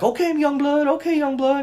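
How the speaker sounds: high mocking old-timer voice, pitched well up; mocking older man. Imitating the bus stop guy taunting the narrator, high and mocking. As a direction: ham up the condescending old guy. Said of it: "And he's like".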